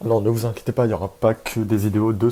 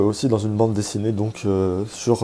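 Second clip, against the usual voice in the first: ordinary delivery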